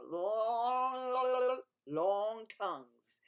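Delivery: slow, stretched long, long; drawn-out long. A narrator's reading. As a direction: stretch the words like a long tongue. Describing gecko's long tongue.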